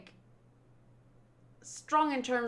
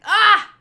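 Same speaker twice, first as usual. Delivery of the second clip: high loud cry; shouted cry; 10 semitones higher, 15 dB louder than usual. Acted. Vivi charging in, a loud high cry. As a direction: let out a sharp yell.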